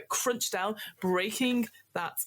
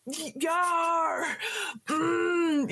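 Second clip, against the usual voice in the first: pirate yell; 9 semitones higher, much slower than usual. Acted. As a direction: shake your fist and holler like a pirate. GM voices pirates shouting obscenities.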